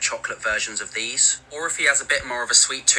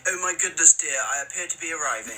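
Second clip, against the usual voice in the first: posh British parody; 6 semitones higher than usual. Pretend. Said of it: "they say stuff like".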